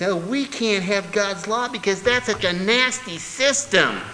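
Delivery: high, mocking whine; whining objector. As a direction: mimic a whiny complainer. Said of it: "whining individual who says".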